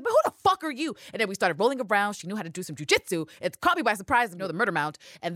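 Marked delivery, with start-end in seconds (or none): 0.0-1.0 mock Eve, higher and pushy; mimicking Eve. Villanelle voicing Eve shouting back, higher mock. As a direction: fire the question back, high. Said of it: "She said".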